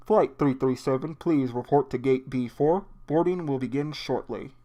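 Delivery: airport announcement over a PA; over the intercom. Acted. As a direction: read it like a gate announcement. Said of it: "walks into the airport entrance".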